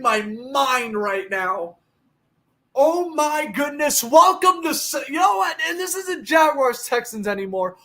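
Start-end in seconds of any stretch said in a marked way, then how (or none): none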